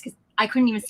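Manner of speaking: normal talking voice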